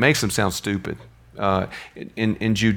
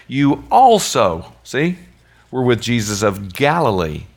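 put-on servant girl; 2 semitones higher, 5 dB louder, slower than usual. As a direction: point the finger at Peter, drawn out. Servant girl accusing Peter.

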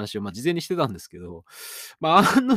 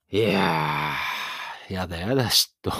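drawn-out theatrical groan over his own jealousy; mock anguished wail; 5 semitones lower, slower than usual. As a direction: ham up a jealous lament, stretching the first word. Self-mocking jealousy lament. (自分の嫉妬を大げさに嘆く芝居がかった声)

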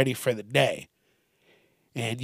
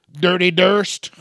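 playful; 5 semitones higher, 6 dB louder than usual. Real, not acted. Playful nickname.

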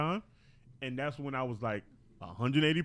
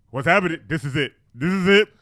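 loud, alarmed outburst; mock panic; 12 dB louder than usual. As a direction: cry out as if the end has come. Acting out panic during sudden numbness.

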